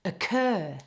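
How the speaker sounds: "occur" with a weak uh, then a strong CUR; stress on second part. Shown as a demonstration. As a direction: model "occur", all emphasis on the second syllable. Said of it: "all the emphasis is on the second part".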